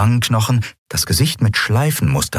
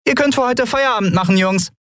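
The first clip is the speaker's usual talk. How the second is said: high, cheery dismissal; cheerful. A narrator's reading. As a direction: send the workers off merrily, voice pitched high. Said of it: "wandte sich an die verunsicherten Hafenarbeiter".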